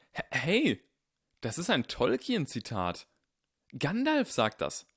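put-on earnest boy insisting he's right; voiced young Harry.

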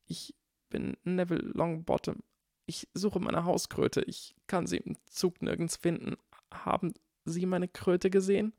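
put-on a nervous child asking for help; timid little boy. A narrator's reading. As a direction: Neville asking Hermine about his toad, tiny and shy. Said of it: "mit winziger Stimme".

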